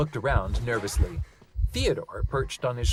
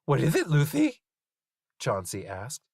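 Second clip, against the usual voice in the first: creature voice; 4 semitones higher, 3 dB louder than usual. Acted. Chauncey voice.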